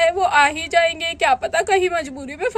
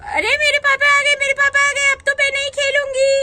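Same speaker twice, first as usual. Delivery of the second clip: put-on excited little girl; 8 semitones higher than usual. Girl cries out to her friends that her father is back, high and excited. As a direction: squeal with joy that papa is home. Child sees father return.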